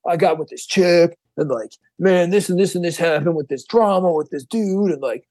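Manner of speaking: slow, rambling party-singer voice; lazy singer. Retold or imitated. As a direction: drag it out like a hungover singer. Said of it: "a singer that was one of these people".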